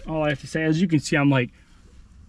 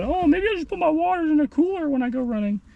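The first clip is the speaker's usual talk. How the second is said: mock eureka thought; 12 semitones higher than usual.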